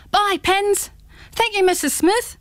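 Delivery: put-on high, bright calling of departing kids; children calling goodbye. Friends leaving the barbecue call to Pins, high and chirpy.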